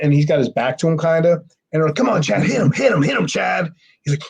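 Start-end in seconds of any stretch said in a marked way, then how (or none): 2.0-3.7 high, urgent chant of egging on; pitched-up mock cheerleading; goading. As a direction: imitate a crowd urging a fight. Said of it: "they're goating him and goating him".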